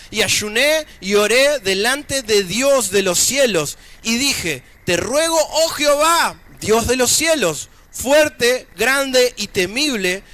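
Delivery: emphatic, projected Bible reading from the pulpit; scripture reading. Acted. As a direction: proclaim the passage phrase by phrase. Reads scripture aloud to congregation.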